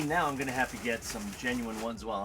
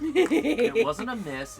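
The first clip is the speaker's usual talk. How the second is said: laughing protest; 9 semitones higher, 9 dB louder, much slower than usual. Real, not acted. Defending dropped chip, laughing.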